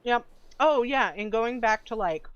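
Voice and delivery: eating chicken while talking; mouth full. Real, not acted. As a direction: talk through a mouthful of chicken. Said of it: "sorry, I'm eating chicken".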